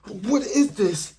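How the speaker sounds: high, loud, frantic mock panic; retold terror, slowed and high on the repeat; panicking. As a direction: shriek it like something unseen is licking your face. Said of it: "I started panicking".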